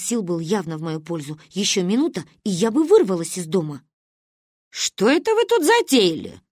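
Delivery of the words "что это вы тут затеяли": raised, thundering demand; booming grandmother. A narrator's reading. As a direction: boom it out to catch the children. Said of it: "Внезапно прогремевший сзади трубный".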